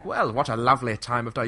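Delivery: mock British toff, lightly hammed-up; exaggerated quaint English accent. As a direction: parody an overdone English accent. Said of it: "you won't hear anyone going".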